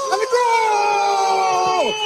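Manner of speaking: drawn-out goal call, loud and high; long goal yell. Real, not acted. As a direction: stretch the goal out at full voice. Commentator calls a goal.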